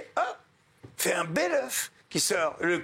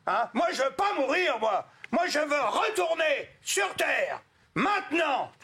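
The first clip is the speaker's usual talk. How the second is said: high, indignant refusal; dead man protests to Saint Peter, high angry voice; 9 semitones higher than usual. Retold or imitated. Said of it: "Très en colère".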